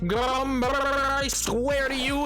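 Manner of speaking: mock furious rant. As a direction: rant as if badly wronged, playing it up. Mock threatening rant at someone.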